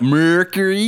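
lightly hammed-up Southern twang; southern accent. Shown as a demonstration. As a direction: put a Southern drawl on Mercury. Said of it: "I have to, like, southern up".

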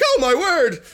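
high-pitched mock alarm; startled Iggy. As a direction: exclaim in a high, hammed-up voice. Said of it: "he drops his net and he's like".

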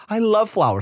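high-pitched imitated delight; teasing imitation of sudden enthusiasm; mock gushing. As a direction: gush like a thrilled mother, exaggerated. Mother's gushing about-face, mimicked.

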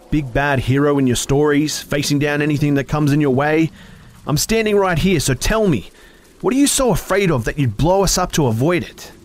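high, loud taunting; angry taunting. A narrator's reading. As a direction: taunt him loudly.

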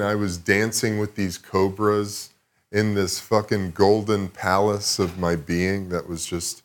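ordinary talk

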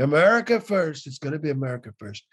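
mild mock tycoon bluster; Trump impression. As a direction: declare it like a rally chant. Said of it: "that long sort of tweet saying".

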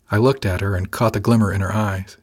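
usual speaking voice

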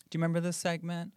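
ordinary talk, nothing marked

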